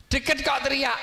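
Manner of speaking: high-pitched mock yell; demonstrated yell. Shown as a demonstration. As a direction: call it out high, showing angry shouting. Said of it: "pasti teriak". (contoh teriakan bernada tinggi)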